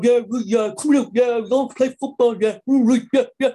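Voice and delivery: put-on strong Hampshire accent. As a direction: send up a brother's broad Hampshire chatter. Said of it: "Why is he talking all funny like that?".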